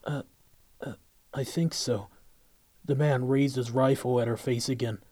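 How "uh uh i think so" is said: nervous stammer; stuttering. Acted. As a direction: stumble over the words in fear. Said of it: "Kelsa began stuttering".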